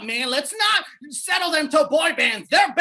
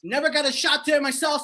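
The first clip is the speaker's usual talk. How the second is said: normal talking voice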